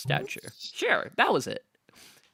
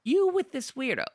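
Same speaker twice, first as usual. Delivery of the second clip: goblin voice; 13 semitones higher, 4 dB louder than usual. Acted. Goblin bard asks Nell.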